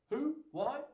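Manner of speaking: high, squeaked questions; baffled, each word shooting up; bewildered. Acted. Orlando's dazed thought.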